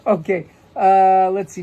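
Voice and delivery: normal talking voice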